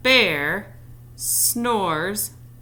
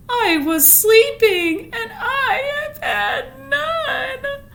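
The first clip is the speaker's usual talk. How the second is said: much higher, slow, crying wail; wailing sad bear; 5 dB louder, much slower than usual. Acted. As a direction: blubber it out like a sulky bear. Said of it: "he whimpers and he moans".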